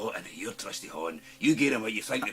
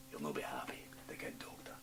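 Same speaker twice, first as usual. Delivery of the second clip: sinister whisper; 6 semitones lower, 10 dB quieter than usual. Acted.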